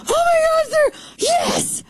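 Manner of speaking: very high gleeful shriek of joy; so excited. Retold or imitated. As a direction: squeal like a fan whose theory came true. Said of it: "And I'm so excited".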